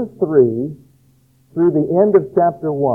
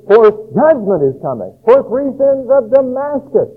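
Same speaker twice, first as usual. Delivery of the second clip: prophet proclaiming; 6 semitones higher, 4 dB louder than usual. Retold or imitated. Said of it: "And he says".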